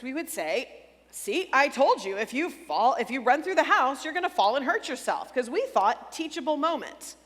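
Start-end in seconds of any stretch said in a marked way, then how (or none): 1.1-5.3 smug told-you-so. Retold or imitated. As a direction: rub it in like a know-it-all parent. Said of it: "just like great parents".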